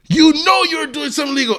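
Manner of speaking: high-pitched imitated outrage; mock indignant cop, voice leaping up; retold accusation. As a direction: burst out as the exasperated officer. Officer accuses him in retelling.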